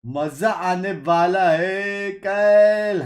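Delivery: loud, high, stretched-out hype; slow, booming build-up of excitement; hyped call-out. Real, not acted. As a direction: draw it out big to pump up the viewers. Hyping tomorrow's match to viewers.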